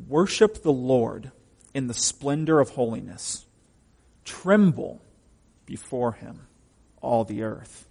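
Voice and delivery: slow, weighty verse with long pauses; emphatic scripture reading. Acted. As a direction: declaim it phrase by phrase, punching "Tremble". Reads scripture aloud.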